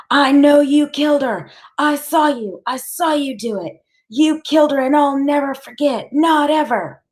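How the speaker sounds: high, loud screamed accusation; screaming. A narrator's reading. Boy keeps screaming at the killer to let Loretta go. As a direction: scream it over and over, never backing down. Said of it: "so all I can do is keep screaming".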